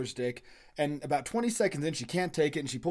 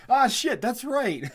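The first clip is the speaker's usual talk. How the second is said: mock realization; 9 semitones higher, 7 dB louder, slower than usual. Dad remembering, loud.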